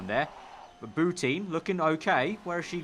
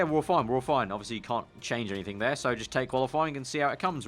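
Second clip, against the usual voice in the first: ordinary talk, nothing marked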